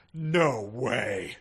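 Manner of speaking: drawn-out sarcastic line; mock sarcasm. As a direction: say it with hammed-up sarcasm. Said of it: "then whispered sarcastically".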